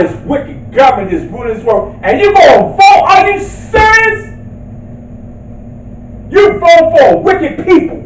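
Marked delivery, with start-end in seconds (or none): 2.0-8.0 indignant shout. Real, not acted. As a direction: challenge the congregation in a raised, outraged voice. Preacher rebukes congregation for voting.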